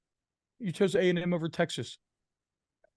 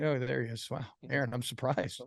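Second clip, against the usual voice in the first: ordinary talk, nothing marked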